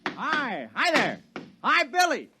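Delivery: eager hailing. Acted. Villager greets Billy at work, eager calling. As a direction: hail a friend eagerly.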